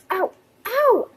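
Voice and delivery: high little yelps; pretend pain. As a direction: yelp as if hurt. Hurt after the tornado.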